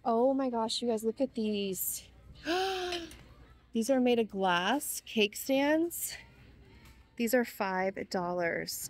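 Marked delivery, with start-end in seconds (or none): 2.4-3.1 quick excited gasp; real excited gasp; delighted gasp. Real, not acted. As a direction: gasp as you spot it. Spots glass cake stands.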